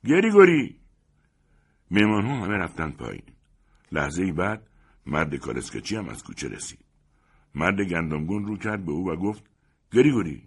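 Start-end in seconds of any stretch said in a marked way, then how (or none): 0.0-0.8 high, very loud shout of a name; loud summoning call. A narrator's reading. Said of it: "رو به سمت کوچه بانگ زد". 9.9-10.4 firm, loud address, moderately raised; imperious address; addressing a servant. Acted. Same name, servant now present.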